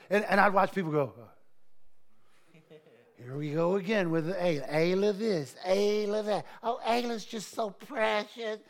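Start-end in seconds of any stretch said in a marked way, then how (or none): 3.2-8.6 a hint of sarcastic, bored mimicry; mocking eye-roll. Pastor mimicking jealous churchgoers, mildly sarcastic. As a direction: sneer lightly at the favorite. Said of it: "that's how they were talking".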